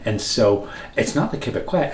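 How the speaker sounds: usual speaking voice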